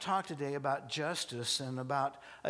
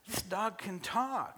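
high, drawn-out disbelief; acted astonishment; 9 semitones higher, slower than usual. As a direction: play it amazed, pitched up and slow. Guy amazed at talking dog.

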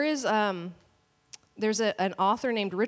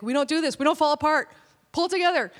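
building to a louder, higher mom scold; a firm mother snapping louder each line; rising stern scold; 7 semitones higher, 4 dB louder, faster than usual. Retold or imitated. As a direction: ramp up the pressure on the kid. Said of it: "I'm like".

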